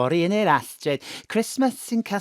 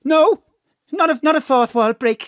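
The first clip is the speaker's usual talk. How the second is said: flustered denial; 8 semitones higher, 7 dB louder than usual. Acted. Mam denies breaking fourth wall.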